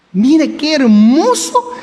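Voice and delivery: ordinary talk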